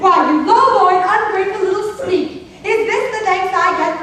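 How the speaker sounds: outraged telling-off; indignant scolding. Acted. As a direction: berate a disloyal servant. Queen berates runaway.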